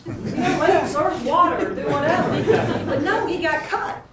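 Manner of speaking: high, loud laughing disbelief; indignant laughter. Real, not acted. Mother telling the audience about her son being cut, laughing indignation. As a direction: laugh at the outrage of it.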